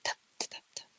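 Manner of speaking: soft unvoiced tap tap imitation; whispered tapping. Imitating the tapping noise heard in the tower, whispered. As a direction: mimic a faint knocking on the walls. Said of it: "It sounds like someone going like".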